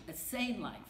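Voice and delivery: ordinary talk, nothing marked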